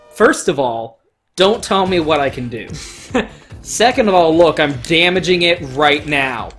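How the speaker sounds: normal talking voice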